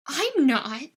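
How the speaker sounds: lightly offended, caught off guard; half-offended splutter. Acted. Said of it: "spluttering out a half-offended".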